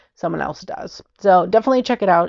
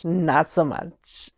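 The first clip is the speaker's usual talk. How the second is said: drier, slower understatement; 5 dB quieter, much slower than usual. Real, not acted.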